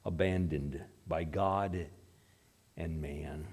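low, slow, subdued last words; retold dying cry. Dying atheist turns his face at last, low subdued cry. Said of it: "he turned his face and he cried".

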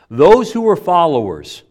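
ordinary talk, nothing marked

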